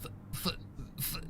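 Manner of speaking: breathless unvoiced stutter on f-; scared stiff, the words won't come out; frightened stutter. A narrator's reading. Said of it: "She kept stuttering".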